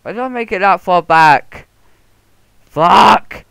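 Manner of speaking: real loud, high-pitched exasperated yell; frustrated shout. Real, not acted. Gamer cursing their own building mistake, frustrated shout. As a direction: yell it, exploding on the swear.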